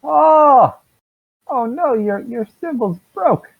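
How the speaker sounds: high, loud gasp of dismay, then high fretting; surprised guard. Acted. Guard gasps at a fellow paladin's broken symbol, high and loud. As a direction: jump at the sight of the broken symbol.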